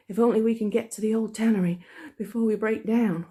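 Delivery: put-on high, loud, out-of-breath boy's voice; gasping it out between breaths; breathless Tom. Boy fleeing a murder scene, panting and high. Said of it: "whispered Tom, in short catches between breaths".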